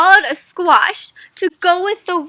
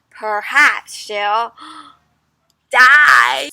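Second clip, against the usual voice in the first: dramatic refrain; 6 dB louder, much slower than usual. Acted. Teacher reading the book's refrain with mock drama. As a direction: draw it out, then spring the last word.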